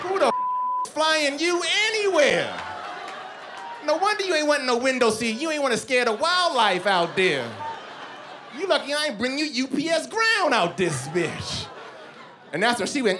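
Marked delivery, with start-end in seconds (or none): 0.0-11.7 yelling, half laughing in outrage; outraged rant. Acted. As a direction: blow up at her in disbelief. Ranting at the woman.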